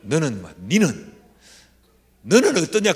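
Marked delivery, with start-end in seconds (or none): 0.6-1.2 니는 in Gyeongsang dialect, louder; blunt Gyeongsang 니는. Shown as a demonstration. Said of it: "이걸 경상도 말로 하면". 2.2-2.9 higher, louder, short and blunt; a curt, weighty divine question; God speaking curt. Retold or imitated. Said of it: "아주 굵고 짧게 말씀하시더라고".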